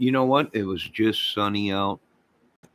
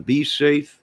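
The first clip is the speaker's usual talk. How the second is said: emphatic sign-off; 7 semitones higher, 6 dB louder than usual. Real, not acted. Parting call to viewers.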